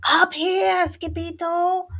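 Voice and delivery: very high, loud call; hollering Chihuahuas. A narrator's reading. Chihuahuas call Skipito from above, hollering high. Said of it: "hollered the Chihuahuas".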